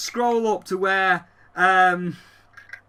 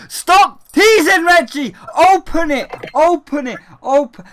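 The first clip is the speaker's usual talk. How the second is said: loud, higher chant of demands; impatient crowd shouting; 6 semitones higher, 7 dB louder than usual. Retold or imitated. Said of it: "Everybody like".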